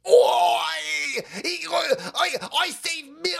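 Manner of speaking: high, slow, strained mock shout of a boastful old doctor; Fauci impression. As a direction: ham it up as a preening old scientist. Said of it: "I can't do the fucking voice anymore".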